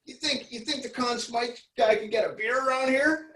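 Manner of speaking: high, hammed-up cocky ask for a beer; hockey goalie's line. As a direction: play the smug champion fishing for a beer. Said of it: "he goes".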